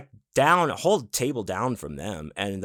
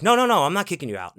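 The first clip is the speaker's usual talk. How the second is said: fast, higher and louder protest; hasty retold denial; 8 semitones higher, 5 dB louder, faster than usual. Staff member reassuring an upset guest, hurried denial. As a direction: rush to correct a misunderstanding.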